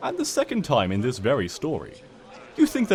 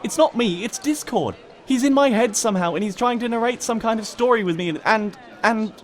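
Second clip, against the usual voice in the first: desperate pleading; 9 semitones higher than usual. A narrator's reading. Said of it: "pleading desperately with the crowd".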